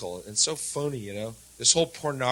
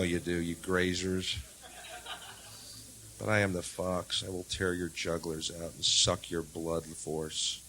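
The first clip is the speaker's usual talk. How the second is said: quiet menacing fox; 5 semitones lower, 9 dB quieter than usual. Acted.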